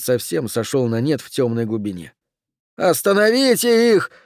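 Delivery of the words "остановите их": very high, desperate order; shouting. Acted. Oxy orders his men to halt the attackers, shouting high. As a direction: cry out urgently for help stopping them. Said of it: "прокричал Окси".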